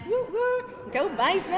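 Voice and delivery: high whooping cheer; school cheer. Real, not acted. Speaker cheering for her university, high whooping woo.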